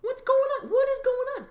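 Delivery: high, laughing hammed-up what-the; mock disbelief. Host reacting to measles numbers for the audience, in a high, laughing mock-shocked voice. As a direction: squeal it in fake shock.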